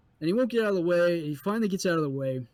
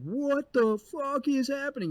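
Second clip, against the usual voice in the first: high, slow stretched-out bewilderment; bewildered disbelief; 11 semitones higher, slower than usual. Retold or imitated. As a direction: drawl it in total confusion. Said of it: "saw that guide going like".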